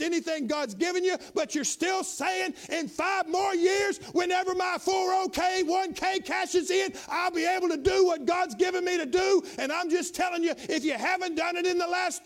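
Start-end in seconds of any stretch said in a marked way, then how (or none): 2.7-9.4 mock procrastinator. Preacher mimics the old man still putting it off, loud. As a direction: throw the old excuse out loud, stretched. Mocking a man forever delaying.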